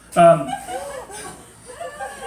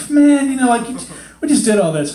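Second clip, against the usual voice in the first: high, loud laughing groan; exasperated parents; 8 semitones higher, 5 dB louder than usual. Retold or imitated. Parents after carrying the stroller down, loud laughing groan. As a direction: moan at the ruined outing. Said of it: "we're like".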